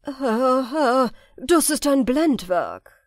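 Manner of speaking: loud, slow alarmed warning; loud and slow, suspicious councillors. A narrator's reading. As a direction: raise the alarm to the sultan. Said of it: "zogen die Brauen in die Höhe und sagten".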